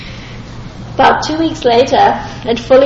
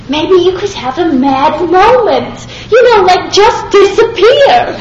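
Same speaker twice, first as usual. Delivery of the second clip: imitating an eager rabbi, higher and louder, stretching disappear; excited rabbi; 5 semitones higher, 4 dB louder than usual. Rabbi eagerly urging him to vanish, imitated with excitement. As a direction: pitch the wild idea with glee. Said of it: "And he's so excited about it".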